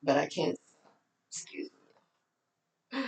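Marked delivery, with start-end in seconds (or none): 1.3-3.0 whisper. Real, not acted. Whispered aside mid talk.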